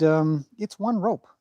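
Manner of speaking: usual speaking voice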